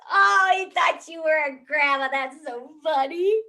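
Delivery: put-on gleeful kid voice teasing mom; laughing kids, high and loud; giggling daughters. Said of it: "my girls are laughing".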